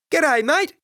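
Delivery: high, loud Australian G'day; a kid nailing a broad Australian accent; cheeky Australian hello; Aussie strine greeting. Acted. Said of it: "Sawyer's attempt at strine is spot on".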